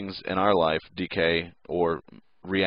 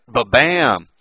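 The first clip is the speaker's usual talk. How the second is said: loud, punched ba-bam; playful collision sound effect; mock impact; 4 semitones higher, 8 dB louder than usual. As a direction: hit it like a cartoon crash. Imitates neutron hitting nucleus.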